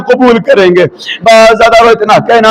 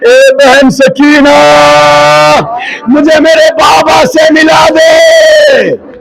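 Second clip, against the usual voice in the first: slow, raised wailing plea; pleading child; 6 semitones higher, slower than usual. Retold or imitated. Child Sakina begs to be reunited with her father, wailing slowly. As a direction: beg for your father through tears. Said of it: "एक जुमला कहा".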